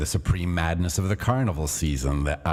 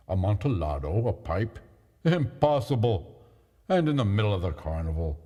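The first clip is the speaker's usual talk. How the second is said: astonished Fortunato; 3 semitones higher than usual. Acted. Fortunato hears of the wine.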